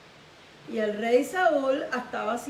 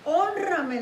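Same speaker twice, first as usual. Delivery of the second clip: higher, louder acted entreaty; pleading; 5 semitones higher, 5 dB louder than usual. Saúl begs Samuel for honor, pleading. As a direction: beg for honor. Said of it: "dice la Biblia que le rogó".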